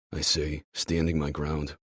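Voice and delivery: ordinary delivery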